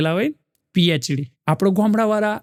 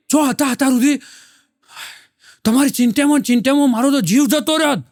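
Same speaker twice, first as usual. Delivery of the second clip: worried wife; 5 semitones higher, faster than usual. Retold or imitated. Wife scolds the late husband, high and worried. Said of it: "પત્ની સીધી કહેવા જ માંડી".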